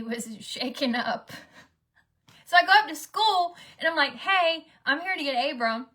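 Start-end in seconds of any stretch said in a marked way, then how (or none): 2.5-5.9 excited retelling. Real, not acted. Excited arriving at school.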